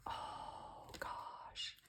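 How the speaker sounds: soft, unvoiced oh gosh; breathless whisper. Real, not acted. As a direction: barely breathe it out in awe. Awed by her embellishments.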